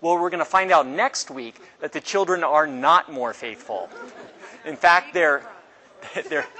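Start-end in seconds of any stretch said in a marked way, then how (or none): 4.6-6.5 laughing. Real, not acted. Laughs at Israel's failings.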